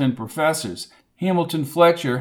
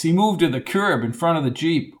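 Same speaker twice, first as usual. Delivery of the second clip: usual speaking voice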